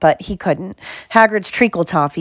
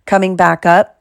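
plain reading voice

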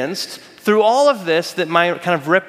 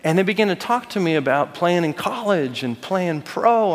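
ordinary talk, nothing marked

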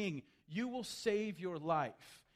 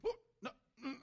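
higher nervous chuckle; nervous laugh; 7 semitones higher, much faster than usual. Retold or imitated. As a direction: laugh it off anxiously. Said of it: "Peter's like".

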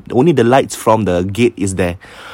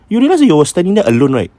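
alarmed; 8 semitones higher, 5 dB louder, faster than usual. Retold or imitated. Friend alarmed questioning.

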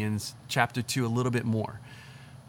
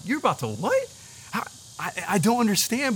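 high, incredulous question; surprised; 8 semitones higher than usual. Retold or imitated. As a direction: ask it in disbelief. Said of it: "And I'm like".

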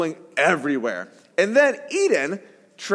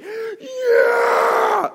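much higher, imitated wild yell; mock the coach going over the top; ballistic cheer; 4 dB louder than usual. Imitating the other coach going wild when a girl scores. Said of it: "the other coach goes ballistic".

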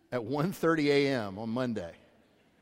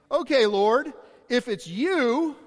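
high, loud call across the water; eager call; 8 semitones higher, 8 dB louder, slower than usual. Acted. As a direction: call out to him, daring. Peter calling to Jesus.

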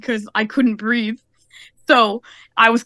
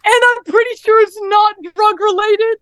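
high, raised-voice imitation of mom; emphatic insistence; 9 semitones higher, 4 dB louder than usual. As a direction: insist it to the operator.

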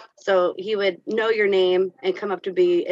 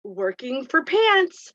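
put-on slow, lightly higher childlike phrase; imitating the student; 6 semitones higher, slower than usual. Student explains to para he is working, slow childlike. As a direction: say it earnestly like a kid.

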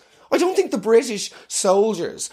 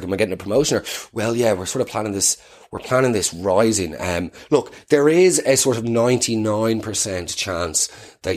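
lower, casual office dodging; mock office chat; 8 semitones lower than usual. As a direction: wriggle out of the job politely. HR scene dialogue continues.

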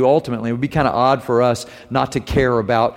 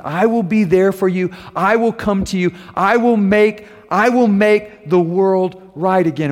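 higher, slow proclaiming of the promise; fervent preaching; 6 semitones higher, slower than usual. Acted. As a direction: preach it out with conviction.

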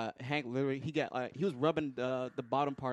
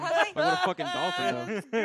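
laughing; 14 semitones higher, 5 dB louder than usual. Real, not acted. Laughing exclamation.

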